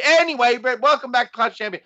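high mock announcer welcome; Jim Ross. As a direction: snap into a high-pitched commentator impression. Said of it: "like, Jim Ross".

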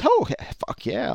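high-pitched acted enthusiasm; mock eagerness. Acted customer eagerly accepts free taste.

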